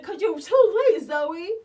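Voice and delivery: hammed-up shout of a hurt boyfriend; retold angry outburst. Retold quarrel, he snaps back.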